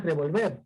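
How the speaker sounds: revolver with the final syllable stressed; stress on last syllable. Shown as a demonstration. Teacher showing the wrong reading, stress on VER in revolver. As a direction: push the weight onto the end of revolver. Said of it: "Y ya no es".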